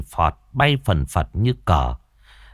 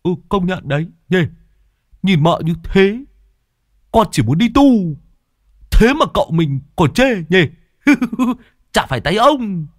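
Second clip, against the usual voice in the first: high, louder sniggering servant voice; cheeky young henchman; 9 semitones higher, 4 dB louder than usual. A narrator's reading. Lâm Sẹo cheekily joking with his master, high and louder. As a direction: snigger along, pitched high.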